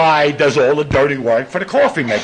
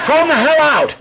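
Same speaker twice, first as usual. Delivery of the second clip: mock outrage; 11 semitones higher, 5 dB louder than usual. Indignant greeter retold.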